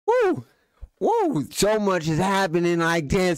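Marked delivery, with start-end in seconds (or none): 0.1-1.5 a high, excited woo; excited whoop. Real, not acted. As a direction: whoop at the action on screen. Reacting to the shootout in the clip.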